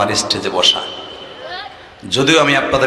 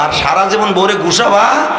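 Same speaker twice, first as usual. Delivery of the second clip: ordinary delivery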